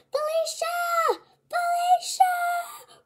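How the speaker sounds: high, slow sing-song calling of a name; mock yelling name. The boy calling across the classroom, high and sing-song.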